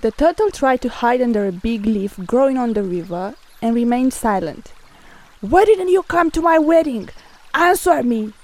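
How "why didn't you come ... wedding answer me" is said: raised, forceful demand for an answer; a god demanding an explanation, pitch pushed up. A narrator's reading. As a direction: press the question hard, higher than narration. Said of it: "Zeus demanded".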